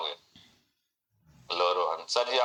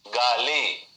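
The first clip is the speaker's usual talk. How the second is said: ordinary talk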